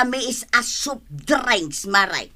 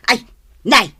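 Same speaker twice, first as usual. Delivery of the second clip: usual speaking voice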